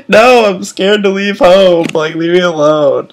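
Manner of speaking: high, loud, weepy snap at the strangers; tearful protest. Retold or imitated. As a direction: whine it out through tears, pushing them away. Crying on plane, answering couple.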